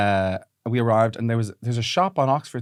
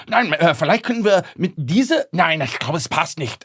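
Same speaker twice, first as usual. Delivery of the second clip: excited German babble; 6 semitones higher than usual. Retold or imitated. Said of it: "speaking German loudly about a phone charger".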